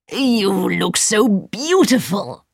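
slow, drawn-out wizard voice. A narrator's reading. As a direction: let the wizard savour it.

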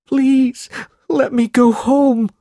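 put-on a terrified boy begging to be let go; desperate high-pitched begging; pleading cry. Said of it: "cried Smike".

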